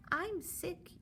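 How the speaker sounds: put-on high, quiet sick-kid whimper; sick child voice. Teacher voices the sick child from the book, high and quiet. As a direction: sound like a poorly child telling mum.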